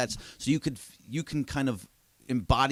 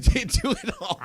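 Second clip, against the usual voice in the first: laughing. Real, not acted. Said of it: "I don't know if that's perfect or terrible".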